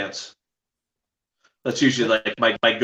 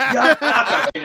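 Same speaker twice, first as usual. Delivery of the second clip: higher, laughing boast of "Knockout King"; laughing mock fighter; 11 semitones higher than usual. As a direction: imitate the fighter proudly insisting on "Knockout King". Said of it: "He goes".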